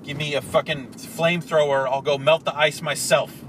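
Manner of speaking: loud furious rant; angry shouting. Retold or imitated. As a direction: yell it like you mean it. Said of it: "like, said".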